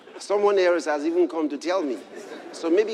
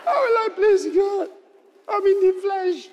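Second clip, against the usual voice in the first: imitating a wailing plea, much higher and louder; hammed-up despairing cry; 4 dB louder than usual. Preacher acting out his past despair to the congregation, mock wailing. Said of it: "Then maybe I started crying".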